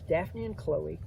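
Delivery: ordinary delivery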